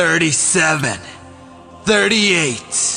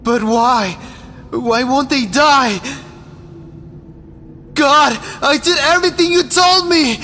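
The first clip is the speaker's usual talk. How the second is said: high, loud, distraught cries; desperate screaming; 10 semitones higher, 6 dB louder, slower than usual. Acted. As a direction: wail it out in despair. Follower despairs that nobody dies.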